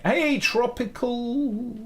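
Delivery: high drawn-out call across the room. Real, not acted. Calling out to Tropical, drawn out and high. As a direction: stretch the name out to reach them.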